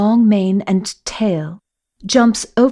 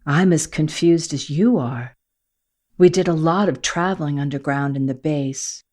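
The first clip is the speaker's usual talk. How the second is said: lightly lower, sheepish admission; confessing Matthew; 4 semitones lower than usual. Acted. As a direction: own up that he's lost too. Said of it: "he confesses".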